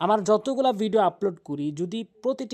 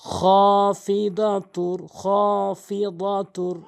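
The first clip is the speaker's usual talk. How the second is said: slow drawn-out খাফিদাতুর; Quran recitation; 4 dB louder, much slower than usual. Shown as a demonstration. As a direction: chant with the alif stretched. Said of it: "এখানে খ আলিফ জবর".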